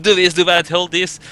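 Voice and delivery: bossy orders. Retold or imitated. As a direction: bark out orders like a stressed organiser. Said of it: "so fucking bossy".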